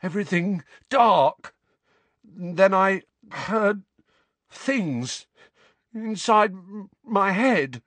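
high, halting, frightened account; shuddering fear. A narrator's reading. Shaken son recalling voices to parents, shuddering fear. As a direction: stammer out the horror piece by piece.

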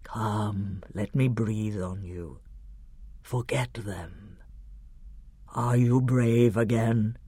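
deep, soft, soothing lion; Aslan. A narrator's reading. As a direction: comfort a frightened child, low and slow. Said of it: "the deep voice said".

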